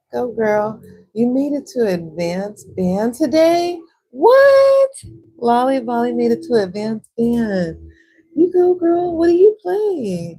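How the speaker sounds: loud, high-pitched hype with a big "What?"; excited cheering. Real, not acted. Streamer cheers viewer who made it to advanced band, excited.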